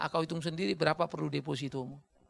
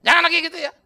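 high loud stern warning; stern aunt warning; 12 semitones higher, 14 dB louder, much faster than usual. Retold or imitated. Aunt warning nephew not to repeat it, loud stern. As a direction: warn like a strict teacher. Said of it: "Ditarik-tarik".

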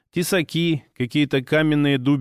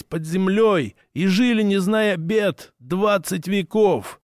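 proud orc voice; 6 semitones higher than usual. Acted. Orc leader's long proud speech.

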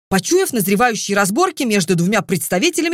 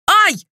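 sharp, much higher, louder yelp; howling ouch, high and loud; yelp of pain; 10 semitones higher, 4 dB louder than usual. A narrator's reading. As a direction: cry out as your ear is pinched. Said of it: "взвыл ненаследный принц".

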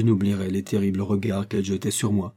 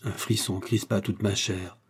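plain reading voice

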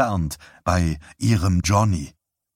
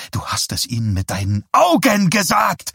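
breaking into a high, loud scream; screaming accusation; 10 semitones higher, 3 dB louder than usual. A narrator's reading. Wife screams at her husband about his eyes, accusing. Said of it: "schrie sie ihn an".

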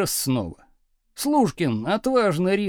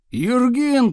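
higher, louder summons; raised tone; 5 semitones higher, 6 dB louder than usual. A narrator's reading. Teacher calling unknown pupil, raised tone. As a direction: call the name louder, pitched up. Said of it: "тоном выше повторил Слушкин".

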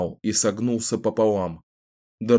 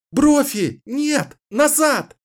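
high, loud cry calling the wolf back; desperate shout; 4 dB louder, much slower than usual. Acted. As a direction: scream at the dog to stop. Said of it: "отчаянно закричала Кэлен". (отчаянно кричит волку)

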